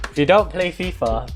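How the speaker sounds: usual speaking voice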